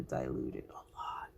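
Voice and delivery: soft whispered aside. Real, not acted. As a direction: murmur it to yourself. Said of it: "whispered aside".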